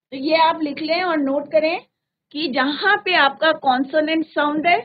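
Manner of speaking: usual speaking voice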